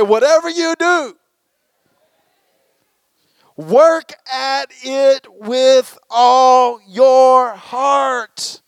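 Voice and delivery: high, sassy Southern attitude, words stretched slow. Pretend. Pastor shows congregation how to say it to a neighbor, sassy Southern attitude. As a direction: wag the finger and drawl it out slowly with sass. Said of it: "with some Southern attitude".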